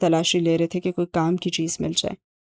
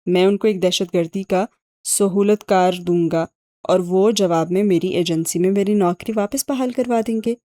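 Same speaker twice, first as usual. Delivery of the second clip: ordinary delivery, nothing marked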